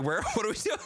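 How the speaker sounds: laughing wife quote. Retold or imitated. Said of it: "And Rachel's like".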